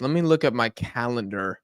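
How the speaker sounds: ordinary talk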